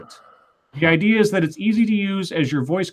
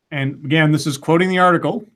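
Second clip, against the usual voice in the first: normal talking voice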